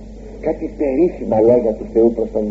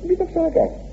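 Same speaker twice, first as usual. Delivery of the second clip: high and hushed; confessor's gentle reply; 5 dB quieter than usual. Acted. Priest answering the penitent, high and soft. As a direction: say it softly as a kind priest. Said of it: "Η απάντηση".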